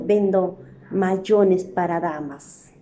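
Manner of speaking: deliberately slow demo reading; slow example. Shown as a demonstration. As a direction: read the example slowly. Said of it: "¿A qué se refiere cuando dice".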